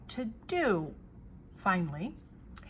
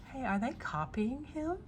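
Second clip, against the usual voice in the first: usual speaking voice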